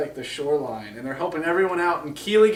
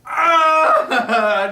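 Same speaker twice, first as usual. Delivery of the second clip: high, loud cry of pain; mock scream; 12 semitones higher, 9 dB louder than usual. Imitating a dwarf who just got shot, mock scream. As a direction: let out a hammed-up yell. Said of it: "he's like".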